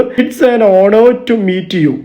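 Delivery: very slow, clear teaching read; slow example. Shown as a demonstration. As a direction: linger on an honor for learners. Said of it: "an hour എന്നാണ് നമ്മൾ പറയേണ്ടത്". (പതുക്കെ വ്യക്തമായി)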